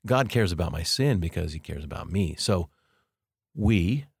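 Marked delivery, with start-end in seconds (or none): 3.5-4.1 single out we before going on; stress on we. Shown as a demonstration. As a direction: lean on the word we and pause after it. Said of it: "notice the we".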